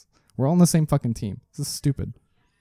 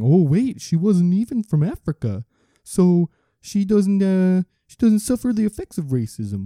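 put-on Canadian accent; 8 semitones higher than usual. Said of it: "I don't know why a Canadian jumped in there".

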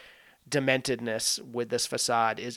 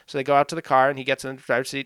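ordinary delivery

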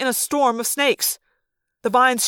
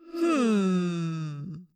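soft low gloating hum; low villain hum; 4 semitones lower, 8 dB quieter than usual. Acted. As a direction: hum with cruel amusement. Villain musing.

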